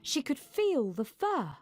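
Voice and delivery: ordinary delivery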